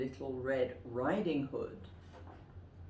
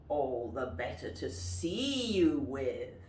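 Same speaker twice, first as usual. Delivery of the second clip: wolf voice; 4 semitones lower than usual. Shown as a demonstration. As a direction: lower wolf line, full voice. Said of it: "And the wolf says".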